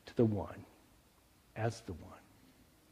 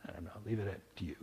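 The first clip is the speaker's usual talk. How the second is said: whispered aside; 4 semitones lower, 12 dB quieter, much faster than usual. Real, not acted. Teasing aside to congregation.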